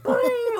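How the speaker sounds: high cartoon boing; alert boing. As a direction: imitate a notification chime. Mimicking the call alert sound.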